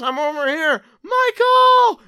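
high, loud calling out; shouting. Acted. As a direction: yell for a lost friend. Shouting to find Michael.

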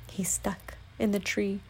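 soft, unvoiced answer; whispered aside. Real, not acted. Quiet aside answering child listener.

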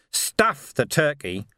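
slow, heavy dismissal; curt, slow. Acted. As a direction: drag each word out impatiently. Vimes cuts him off.

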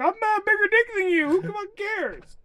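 high, mocking braggart voice. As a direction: sneer it out in a high put-on voice. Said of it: "be like".

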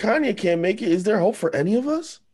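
very high, pleading mock despair; sarcastic. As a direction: wail the question tongue in cheek. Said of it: "I was being sarcastic".

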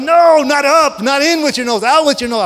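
high, loud exasperated correction; irritated yelling. Retold or imitated. Father correcting his toddler's sniffing, loud and exasperated. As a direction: yell the fix at her, fed up. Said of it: "I'm getting very irritated".

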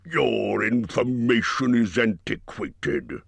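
snapping dragon. A narrator's reading. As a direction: bite the words off in irritation. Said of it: "he snapped".

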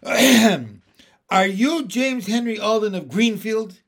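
put-on excited boy's big, high-pitched question. A narrator's reading. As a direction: ask it slowly and loudly, bursting to know. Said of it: "saw how excited Henry was".

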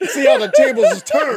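loud, very high laughing taunt; gloating taunt. Pretend. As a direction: crow over the beaten bear. Playacting taunting the bear.

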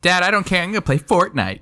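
high-pitched mock kid's reply to Dad; imitating a child. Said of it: "She said it exactly like that".

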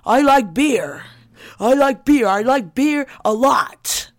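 shouting politician. Retold or imitated. Imitating Kavanaugh at hearing.

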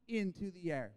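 normal talking voice

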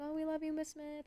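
put-on high, soft childlike voice; lightly voiced sweet students; sweet kids' voice.

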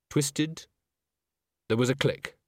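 plain reading voice

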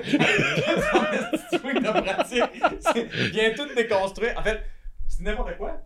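parle à travers un fou rire; en riant. Real, not acted. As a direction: rire qui éclate dans les mots. Rit en décrivant le swing. (en riant aux éclats)

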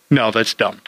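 ordinary delivery

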